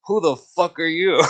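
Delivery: high, loud indignant challenge; mock confrontation. Retelling a challenge to a stranger walking up the driveway, indignant.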